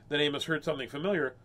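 usual speaking voice

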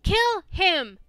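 loud, raging yell; furious shout. Real, not acted. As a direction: scream at the enemy. Yelling during game fight.

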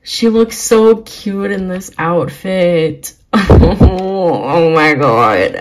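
gasping, gushing with bursts of loud laughter; freaking out. Real, not acted. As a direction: gush helplessly over something adorable. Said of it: "I'm, like, freaking out right now".

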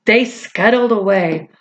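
plain reading voice

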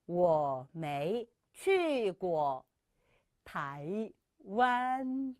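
very slow, tones drawn out; slow teaching read; slow model sentence. Shown as a demonstration. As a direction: model each syllable slowly. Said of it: "다시 한번".

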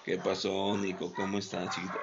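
low, soft, gentle greeting; soft and tender. Shown as a demonstration. Trainer greets the dog Nico softly and lovingly. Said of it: "le hablo suavecito".

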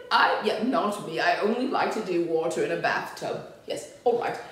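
usual speaking voice

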